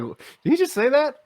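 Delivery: high, stunned disbelief; surprised. Retold or imitated. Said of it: "And I was just like".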